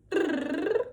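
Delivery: slow buzzing brrr; a lip-trill brrr. Shown as a demonstration. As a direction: trill the lips loosely. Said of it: "the lip buzz".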